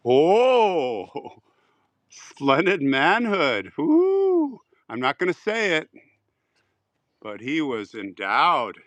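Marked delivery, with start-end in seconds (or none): 0.1-1.4 breathless laugh into a high oh. Real, not acted. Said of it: "I can't believe that". 2.1-3.7 slow, relishing each word of splendid manhood; slow mock-admiring repeat; savoring it. Savoring the phrase, amused. 3.8-4.6 high excited woo; high whooping cheer. Real, not acted. Reacting to splendid manhood joke.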